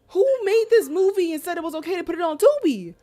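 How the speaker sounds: high, loud incredulous yell; retold outrage. Viewer demanding to know who put this on Tubi, outraged yell.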